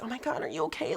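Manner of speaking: a friend checking on her, high and hushed; worried friend. Retold or imitated. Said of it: "tall friend was like".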